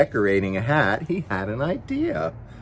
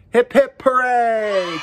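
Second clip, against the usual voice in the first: high, loud hip hip hooray; shouted cheer; 10 semitones higher, 4 dB louder, slower than usual. A narrator's reading. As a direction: shout it out for the whole party. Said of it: "Cousin Dudley shouted".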